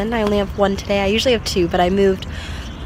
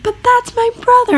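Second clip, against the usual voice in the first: put-on very high, louder child's whine; kid protesting; 5 dB louder than usual. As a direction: protest like an indignant little boy.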